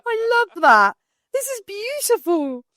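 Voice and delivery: high, touched and gushing; delighted. Real, not acted. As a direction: squeal with delight over the gift. Receiving a thoughtful Christmas gift.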